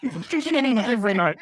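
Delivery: "Not every night" high, slow and laughing; mock his protest through laughter; laughing mimicry. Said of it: "Don't say it like that".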